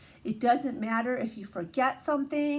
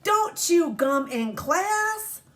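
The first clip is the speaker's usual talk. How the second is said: strict teacher; 7 semitones higher, 5 dB louder, much slower than usual. Acted. Teacher's rule.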